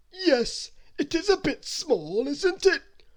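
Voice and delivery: Mister Greedy, shrugging off a cake, in a high slow voice; greedy character voice. Acted.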